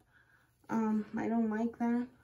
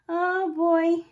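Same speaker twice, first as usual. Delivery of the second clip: normal talking voice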